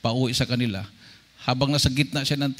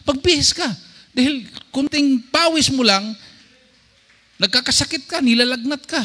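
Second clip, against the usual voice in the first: fussing wife; 10 semitones higher than usual. Retold or imitated. Wife scolds soaked husband.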